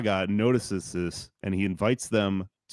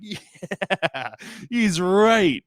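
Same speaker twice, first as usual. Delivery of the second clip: high, drawn-out mock cheer; imitated cheering crowd; 9 semitones higher, 4 dB louder, much slower than usual. Everyone at the conference agreeing, imitated cheer. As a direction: act out a crowd swept into agreement. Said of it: "And everyone's like".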